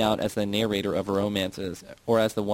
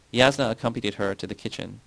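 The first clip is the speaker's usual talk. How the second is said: plain reading voice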